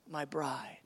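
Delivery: low, hushed words to a beloved; tender whisper. Acted. God speaking tenderly to his bride.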